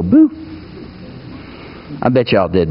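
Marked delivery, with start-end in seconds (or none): 0.0-0.3 sudden, punchy mock scare; short burst to startle someone; mock startling boo. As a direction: pop out a playful boo. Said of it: "pop out around the corner and go".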